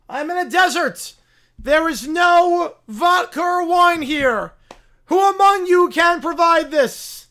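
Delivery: high, loud zealot sermon to the rats; rally the rodents like a mad prophet; preaching bear. Acted. Bear demands wine and vodka.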